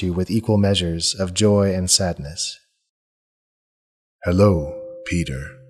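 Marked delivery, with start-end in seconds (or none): 4.2-5.6 a lightly voiced ancient stag, low and gentle; timeless voice. The great stag greets the dying paladin, gently timeless. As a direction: greet him like an old, unhurried spirit. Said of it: "he says in his timeless voice".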